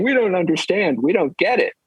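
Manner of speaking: high, whiny puzzled voices; confused crowd. Retold or imitated. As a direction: complain together in confusion. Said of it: "everyone's saying".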